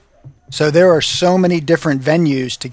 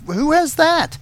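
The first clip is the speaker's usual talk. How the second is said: incredulous; 11 semitones higher, slower than usual. Retold or imitated. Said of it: "And I'm like".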